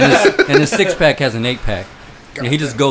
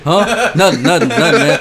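loud, higher imitation of someone caught staring; mock-panicked innocence; flustered denial; 5 semitones higher, 7 dB louder than usual. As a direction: mimic a startled, hasty backtrack. Starer caught, denies it.

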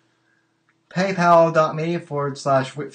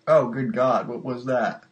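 ordinary talk

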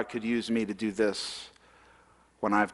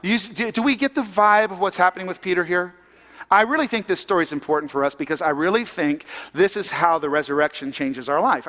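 ordinary delivery

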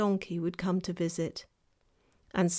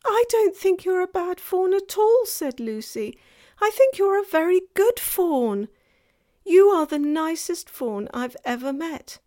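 Lucy consoling; 9 semitones higher, 7 dB louder than usual. A narrator's reading.

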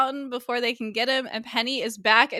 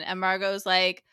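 ordinary talk

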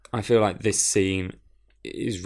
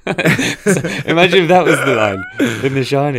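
laughing; 6 semitones higher, 9 dB louder than usual. Real, not acted. Laughing at the silly line.

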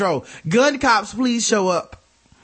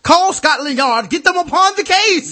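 higher, louder, hammed-up cry for help; mock alarm; 9 semitones higher, 5 dB louder than usual. Host hams up a Victorian call for Scotland Yard, mock alarm.

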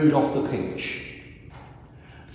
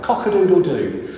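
louder mock cock crow; rooster crow; 4 semitones higher, 6 dB louder than usual. Cock crows in story.

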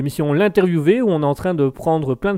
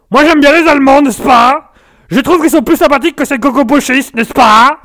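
much higher, much louder put-on politician voice; Jean-Marie impression; 10 semitones higher, 10 dB louder than usual. Streamer interviews a fake guest, pompous high loud voice. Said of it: "Jean-Marie, qu'est-ce que vous en pensez?".

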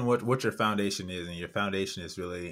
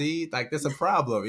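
teasing parent; 7 semitones higher, 4 dB louder than usual. Retold or imitated. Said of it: "I'm like".

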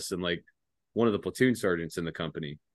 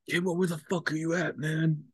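higher put-on voice of a drunk friend calling; drunk buddy on phone; 9 semitones higher than usual. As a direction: lightly voice a tipsy buddy asking where you are. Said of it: "he's like".

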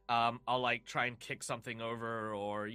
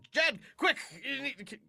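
much higher, louder urgent call; 11 semitones higher, 4 dB louder than usual. Acted. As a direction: shout for help. Oksana urgently calls Jed.

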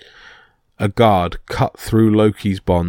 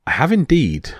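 high, lilting, pleased reply; smiling; 7 semitones higher than usual. A narrator's reading. Loki reporting his find to Thor, smiling. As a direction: answer with a smile, pitch swooping up. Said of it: "smiled Loki".

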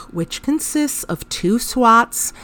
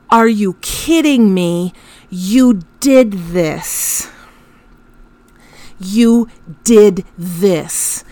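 slow, drawn-out furious disbelief; exasperated; slower than usual. Retold or imitated. Mother reenacting her furious reply to her son. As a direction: spell out the accusation slowly in outrage. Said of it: "that was my tone of voice".

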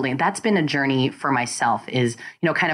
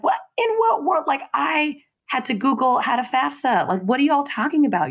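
high-pitched incredulous squeal of protest; hammed-up indignant disbelief, voice shooting up; 12 semitones higher than usual. Guest retelling their shock at classmates' parents doing taxes, indignant. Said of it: "angry and indignant".

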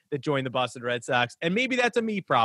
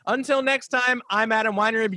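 much higher, bright announcer intro of his name; host sign-off; 7 semitones higher than usual. Acted. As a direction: sign off the show like a radio host.

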